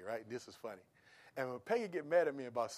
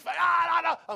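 high squealing protest; imitating wife's squeal; 13 semitones higher, 13 dB louder, slower than usual. Husband mimics his wife squealing as he kisses her. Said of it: "And she".

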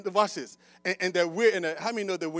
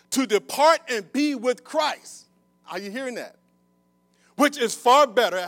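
raised, loud sermon delivery with punched key words; forceful preaching; 7 semitones higher, 10 dB louder than usual. Real, not acted. Preacher quoting Paul to the congregation, forceful. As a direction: preach it out to the whole room.

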